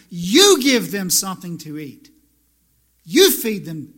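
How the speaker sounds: loud, firm order; firm command. Retold or imitated. Jesus answering the disciples, loud and firm. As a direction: lay down the command. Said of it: "And Jesus answered them".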